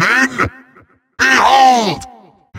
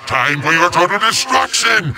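usual speaking voice

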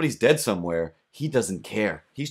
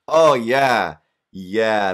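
loud mock neighbor; 4 semitones higher, 10 dB louder, much slower than usual. Neighbor admits hearing everything.

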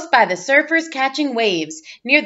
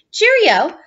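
mock British cheerio; 7 semitones higher, 4 dB louder than usual. Greeting the Queen.

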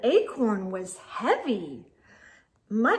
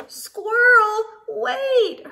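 high, louder call after her; 9 semitones higher, 4 dB louder, much slower than usual. Acted. Friends calling Squirrel back once more. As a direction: call to someone racing away. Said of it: "her friends called".

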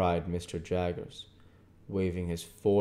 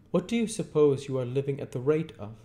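higher, louder, fast interrogation; brisk cross-examining lawyer; 8 semitones higher, 7 dB louder, faster than usual. A narrator's reading. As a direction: fire the question like in court. Jaggers questioning Pip.

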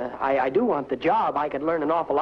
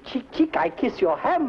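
much higher, fluttery fake French; high mock flirt; 11 semitones higher than usual. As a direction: flutter the words up high like a dandy.

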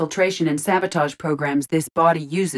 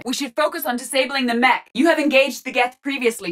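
higher-pitched tactical callout; urgent combat advice; 7 semitones higher than usual. Acted. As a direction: advise the commander mid-fight. Advising commander in combat.

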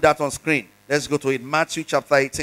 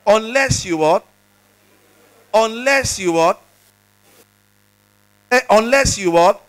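louder each time, calling for an answer; preacher calling the congregation to answer louder; prompting congregation; 3 semitones higher, 4 dB louder than usual. Real, not acted. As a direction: push the crowd to respond. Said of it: "I didn't hear you".

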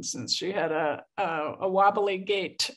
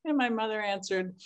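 normal talking voice

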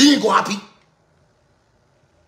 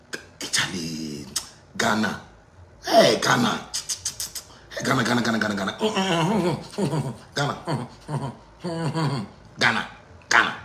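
dismayed lament; 7 semitones lower, 7 dB quieter than usual. Real, not acted. Speaker lamenting Ghana over the headlines, low hushed dismay. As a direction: sink low and soft, murmuring in dismay.

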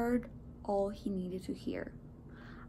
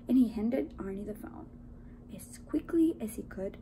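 plain reading voice

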